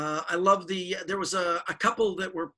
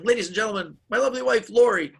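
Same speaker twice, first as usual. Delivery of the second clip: stage announcer; 4 semitones higher, 7 dB louder than usual. Retold or imitated. Stage show introduction.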